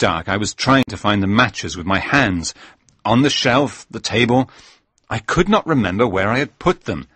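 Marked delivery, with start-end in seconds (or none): none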